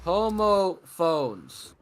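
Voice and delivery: drawn-out teacher scold; slow scolding. Shown as a demonstration. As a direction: stretch out "homophones" like a lecturing teacher. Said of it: "I'm an English teacher".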